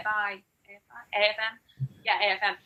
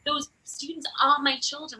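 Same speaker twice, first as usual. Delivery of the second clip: usual speaking voice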